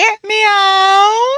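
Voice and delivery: drawn-out meow; cat meow. Pretend. Prank caller meows at uncle.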